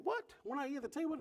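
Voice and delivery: sudden high startled what; jolted surprise; husband startled at the tv tray; surprised husband. Retold or imitated. He's thinking.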